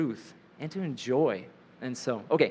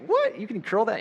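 shrill, much higher startled question; surprised disbelief; 7 semitones higher than usual. Retold or imitated. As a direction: squeak it out in disbelief. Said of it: "I'm like".

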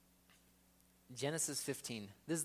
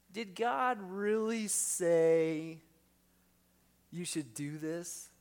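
higher and louder, very slow tempter; voice of deception; 5 semitones higher, 6 dB louder, much slower than usual. Acted. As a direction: stretch every word to plant doubt. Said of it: "that voice of deception".

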